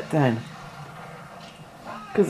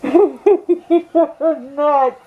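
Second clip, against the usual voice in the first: laughing high disbelief; 8 semitones higher than usual. Real, not acted. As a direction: laugh it out, amazed. Friend laughs at pepper eater.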